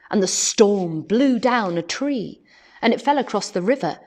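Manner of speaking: plain reading voice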